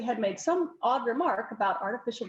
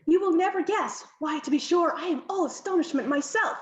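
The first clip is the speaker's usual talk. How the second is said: lightly put-on upper-class accent, higher; affected posh accent; 6 semitones higher than usual. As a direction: cousin showing off new airs, mildly. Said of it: "have you developed an accent?".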